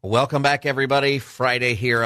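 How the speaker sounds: bright, loud welcome back; radio announcer. Acted. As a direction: greet listeners with a lift. Host returns from break.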